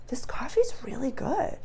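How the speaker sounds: soft whisper about the coffee; whispered aside. Retold or imitated. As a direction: whisper it like a calm picnicker. Picnickers chatting, not watching.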